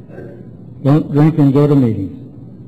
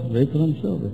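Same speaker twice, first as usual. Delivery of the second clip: softer, compliant echo; writing it down; 7 dB quieter, faster than usual. Retold or imitated. Newcomer writing what sponsor dictates, echoing softly. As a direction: repeat it quietly while jotting. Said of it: "you write and I'll dictate".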